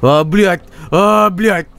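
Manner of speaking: lightly mimicking a man woken into crisis; high-pitched mock groggy dismay; existential crisis. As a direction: play a rudely woken man swearing in Russian. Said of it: "fucking having an existential crisis".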